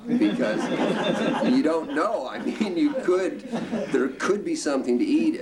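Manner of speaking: chuckling through the words; amused laugh running under the line; high laughing delivery. Real, not acted. Laughing about dad's salt shaker.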